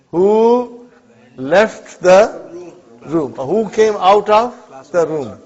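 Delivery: very slow, loud translation; slow model answer. Shown as a demonstration. Teacher to students, modelling the translation very slowly. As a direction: draw each word out for the class. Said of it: "Only translate".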